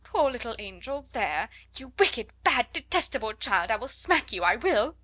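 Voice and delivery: put-on teacher helplessly berating a screaming child; helpless scolding. A narrator's reading. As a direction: scold in flustered despair. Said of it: "helplessly and excitedly".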